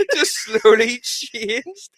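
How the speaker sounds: high, slow words through laughter; helpless laughing. Real, not acted. Recalling his accent drifting, helpless laughing. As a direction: struggle to speak while laughing.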